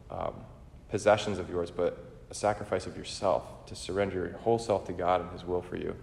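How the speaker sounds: normal talking voice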